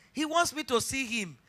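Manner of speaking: ordinary talk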